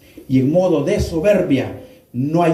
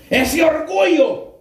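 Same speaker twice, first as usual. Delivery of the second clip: preaching shout; 11 semitones higher than usual. Real, not acted. Preacher calls out to congregation.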